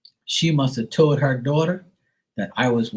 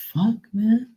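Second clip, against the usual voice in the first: mock disbelief; 10 semitones higher than usual. Retelling his shocked reaction.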